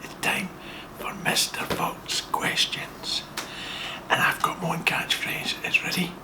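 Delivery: hushed secret whisper. Real, not acted. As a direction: whisper so she can't hear. Whispering so wife won't hear.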